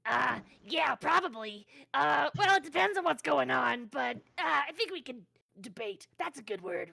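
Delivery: a hint of a goblin voice. Shown as a demonstration. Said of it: "get a taste of your goblin voice".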